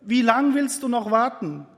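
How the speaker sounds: high, loud plea to God; Habakuk crying out. Acted. Habakuk pleading with God, loud and high. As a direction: cry out to the Lord, impatient and desperate. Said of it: "steht da und sagt".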